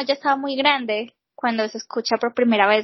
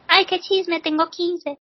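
higher, lightly girlish mock voice; playing a teen girl; 6 semitones higher, slower than usual. Teased about sounding twelve, lightly girlish playful reply. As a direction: play along as a young girl, lightly. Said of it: "niña de doce años".

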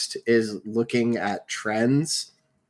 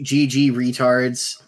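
slow drawn-out catchphrase; 3 dB louder, much slower than usual. Pretend. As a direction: draw out each letter slowly.

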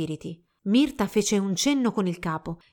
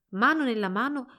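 usual speaking voice

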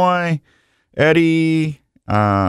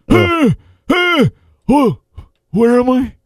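put-on high, gasping, halting old-man act; confused old man; 12 semitones higher, slower than usual. Host mocks his own ageing for his co-host, confused gasping old man. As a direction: gasp and falter like a lost old man.

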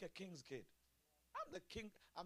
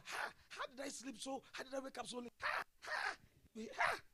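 mock startled self; 10 semitones higher than usual. Mock self-scolding after oversleeping.

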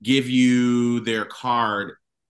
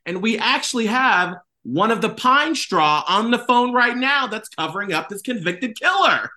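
mock news anchor; 7 semitones higher than usual. Mock radio news intro.

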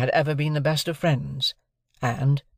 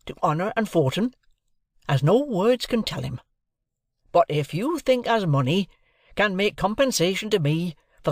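high-pitched, heartfelt country man; earnest and tender, voice lifted; gentle blacksmith Joe; 6 semitones higher than usual. A narrator's reading. Joe gives up Pip to honour and fortune, earnest.

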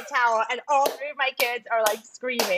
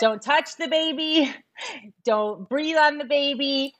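put-on slow, higher-pitched warning to little kids; retold mother's warning; 4 semitones higher, slower than usual. Mother warning her kids off the newborn, slow retold warning. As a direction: spell it out slowly like a wary mom.

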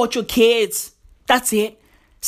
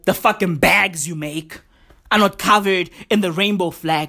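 usual speaking voice

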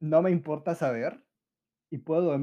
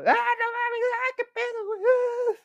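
much higher, shrill screaming in fright; mock scared screaming; 4 dB louder than usual. Teen in the dark haunted classroom, screaming in fright. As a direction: shriek like a terrified teen. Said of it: "andes chillando y gritando".

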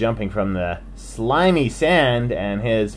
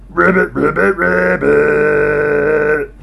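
slow, louder croaking ribbits; frog croak; 4 semitones higher, 6 dB louder, slower than usual. Acted. Frank the Frog answers with slow croaking ribbits. Frog before big jump.

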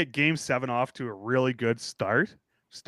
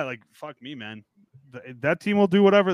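ordinary talk, nothing marked

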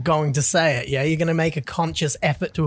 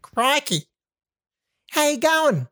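higher, put-on Aussie greeting; Aussie accent; 7 semitones higher, slower than usual. Shown as a demonstration. Host told to talk normal Aussie, hammed-up Aussie accent. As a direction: exclaim in a playful Australian accent. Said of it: "just talk in a normal sentence in Aussie".

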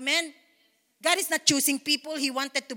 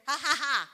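a put-on laugh of faith; deliberate holy laugh. Shown as a demonstration. Pastor showing a faith-led laugh, deliberate and light. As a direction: laugh on purpose to open up. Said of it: "It can be fake right now".

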